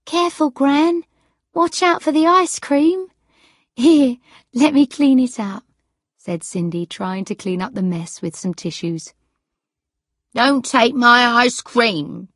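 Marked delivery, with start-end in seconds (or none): 0.1-5.6 high, louder, fussing granddaughter; anxious fussing. A narrator's reading. Cindy cleaning up Gran's melting ice cream, fussing. 10.3-12.3 slow, loud, indignant protest; shouting. A narrator's reading. Sarah protecting her ice cream from Cindy, shouting. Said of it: "shouted Sarah".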